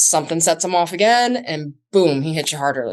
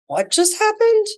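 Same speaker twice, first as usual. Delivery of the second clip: sudden high-pitched shock, voice jumping up; stunned disbelief; 3 dB louder than usual. Pretend. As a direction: caught off guard, can't believe it. Victim stunned after first hit.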